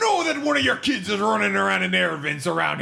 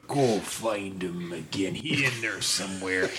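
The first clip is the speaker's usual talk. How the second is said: low voice to dog; 11 semitones lower, 5 dB quieter than usual. Acted. Player talks to dog.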